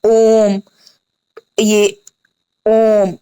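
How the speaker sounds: slow, deliberate example words; teacher reading examples slowly; slow, stretched example. Shown as a demonstration. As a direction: draw out ओम slowly. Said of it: "इसमें लिखा होता है ओम".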